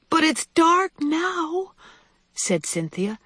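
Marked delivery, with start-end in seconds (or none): 0.1-1.7 put-on high, slow, worried child's voice; scared child. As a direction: draw it out high like an anxious little one. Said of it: "I'm scared".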